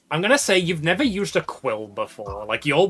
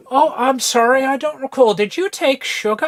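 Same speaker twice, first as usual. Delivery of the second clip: put-on high, loud fussing old mayor voice; a fluttery host apologising over tea; flustered mayor; 11 semitones higher, 5 dB louder than usual. Mayor to his guests, in a high, loud, fluttery voice.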